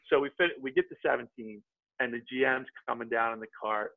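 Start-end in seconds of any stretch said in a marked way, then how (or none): none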